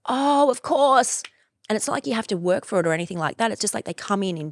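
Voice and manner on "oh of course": higher, louder and slow; drawn-out dawning oh; mock realisation. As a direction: act out a sudden realisation. Realising something, acted quote.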